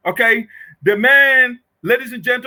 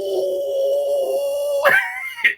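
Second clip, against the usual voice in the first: shrill mocking laugh; 3 dB quieter than usual. Real, not acted. Mocking the opponent's emphasis.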